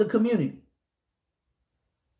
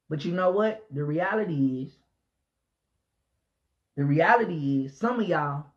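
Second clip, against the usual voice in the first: usual speaking voice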